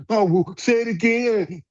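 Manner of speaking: high, slow, mocking take on a timid man; mocking imitation. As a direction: mimic him sneeringly, drawn out and high.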